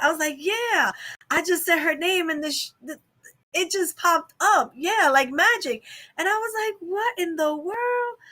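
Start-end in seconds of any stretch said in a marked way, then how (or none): 0.4-5.8 high-pitched, emphatic insistence; amazed retelling. Retold or imitated. Retells telling husband the amazing result. 6.9-8.2 high, drawn-out disbelief; retold astonishment, slow and high; astonished. Retells stunned reaction to winning.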